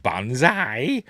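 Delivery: drawn-out imitated show announcer; Bert Kwok. Imitating a game-show voiceover saying the title, drawn-out. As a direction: stretch it out slowly, rising at the end. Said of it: "they'd have Bert Kwok".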